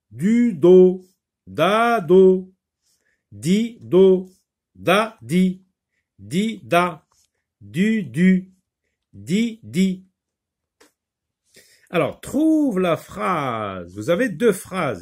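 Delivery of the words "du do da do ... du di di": teacher repeating the syllables more loudly; louder repeat of syllables. Shown as a demonstration. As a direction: di du, du do repeated louder and higher, each syllable punched. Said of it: "on répète, on recommence".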